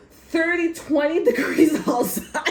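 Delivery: high, loud outburst cracking into laughter; incredulous laughing. Real, not acted. Ranting about flip-flops in twenty degrees, incredulous laughing. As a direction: exclaim it in disbelief, laughter breaking in.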